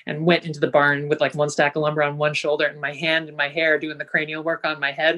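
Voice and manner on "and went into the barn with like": ordinary talk, nothing marked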